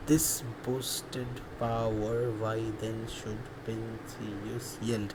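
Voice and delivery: slow, hushed syllable-by-syllable reading, with "power" in full; low, soft and drawn out, as if counting beats; sounding out syllables. Real, not acted. Teacher testing the verse's syllable count, quietly to oneself.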